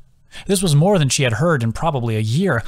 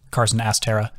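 ordinary delivery, nothing marked

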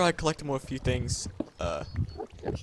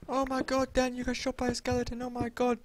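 high-pitched mock whining; mock dismay; 9 semitones higher than usual. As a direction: tease in a high, put-on voice. Mocking friend shot by skeleton.

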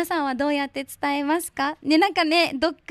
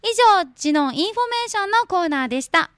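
loud, slow announcer read of a phone number; loud on-air info announcement; radio announcer read; 5 semitones higher, 7 dB louder, slower than usual. Acted. Reads a phone number and a station info slot.

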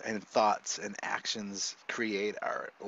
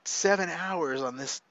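mock scared father; 5 semitones higher, 7 dB louder than usual. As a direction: beg her to cover up, fretting and a bit louder. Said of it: "then I'm scared".

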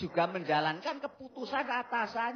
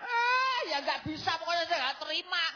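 whiny protest; 6 semitones higher than usual. Acted. Karyo protests missing gas stove.

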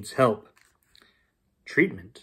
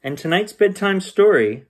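ordinary delivery, nothing marked

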